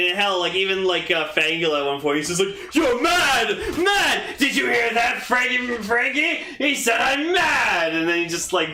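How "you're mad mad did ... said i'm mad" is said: shouting, ranting mad scientist. Retold or imitated. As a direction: rant like an unhinged villain. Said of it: "sounds like a mad scientist".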